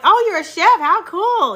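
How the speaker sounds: thrilled to hear it; excited. Real, not acted. Delighted at viewer comment.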